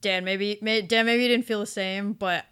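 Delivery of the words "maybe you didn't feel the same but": normal talking voice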